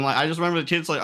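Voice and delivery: ordinary talk, nothing marked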